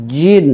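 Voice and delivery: জিন with a firm, hard j; strong stopped j in জিন. Shown as a demonstration. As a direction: hit the j of জিন firmly. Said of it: "শক্ত করে উচ্চারণ".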